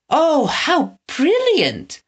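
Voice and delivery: put-on high, slow, delighted girl's cry; delighted exclaim. A narrator's reading. Winnie hears the box trolls escaped, delighted high cry. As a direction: gush with delight at the news. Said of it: "Winnie exclaimed".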